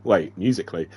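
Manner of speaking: plain reading voice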